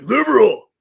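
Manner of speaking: exaggerated cry of the name; put-on announcing voice. Shown as a demonstration. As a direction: belt out the name in a silly put-on voice. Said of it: "I gotta say it in that voice".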